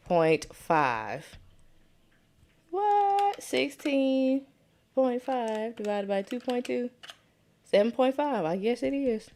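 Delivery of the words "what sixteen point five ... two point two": sudden high startled "What?"; surprised what. Real, not acted. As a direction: caught off guard by her own result. Surprised by her own answer.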